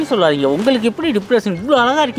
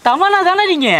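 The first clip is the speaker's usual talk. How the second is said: excited fan; 8 semitones higher, 6 dB louder, slower than usual. Acted. Excited fan asking actress.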